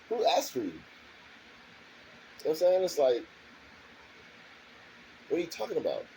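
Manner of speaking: heated rant. Real, not acted. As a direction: go up high and tear into them.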